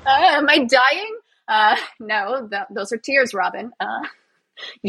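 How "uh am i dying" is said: higher-pitched, comically alarmed confusion with a laugh; mock bewilderment. Robin baffled by her own tears, mock bewilderment. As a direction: play baffled by your own body, half laughing.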